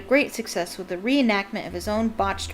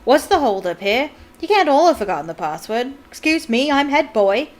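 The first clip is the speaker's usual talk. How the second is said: put-on louder, pompous head-boy bluster; self-important; 4 semitones higher, 7 dB louder than usual. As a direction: puff yourself up and demand way. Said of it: "bustling importantly through the crowd".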